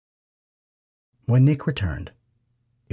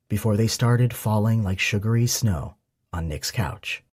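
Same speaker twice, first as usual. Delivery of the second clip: plain reading voice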